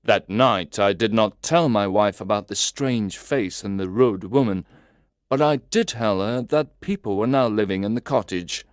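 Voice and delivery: ordinary delivery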